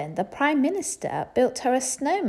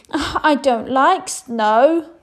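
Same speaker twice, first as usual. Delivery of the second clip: sulky princess; 5 semitones higher, 9 dB louder, much slower than usual. Acted. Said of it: "she sniffed".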